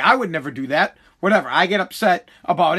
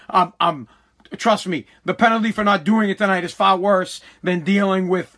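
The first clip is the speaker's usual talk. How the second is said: ordinary talk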